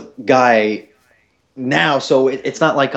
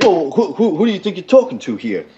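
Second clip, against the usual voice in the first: mock affront; 6 semitones higher than usual. Customer's affronted comeback, retold.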